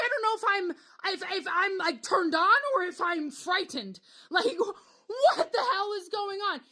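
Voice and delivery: high-pitched, halting confusion; flustered bewilderment. Retold or imitated. Woman watching her date with the machete, flustered and high. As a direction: stumble through it, squeaky with shock. Bewildered, torn between fright and attraction.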